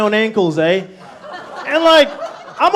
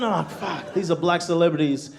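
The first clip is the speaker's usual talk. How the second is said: ordinary talk, nothing marked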